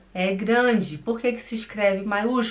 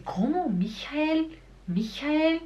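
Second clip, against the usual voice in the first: higher-pitched, questioning echo of a foreign name; puzzled Brazilian; 7 semitones higher, slower than usual. Acted. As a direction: play a listener who didn't catch the name. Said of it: "o brasileiro vai dizer". (brasileiro confuso, repetindo o nome em tom agudo)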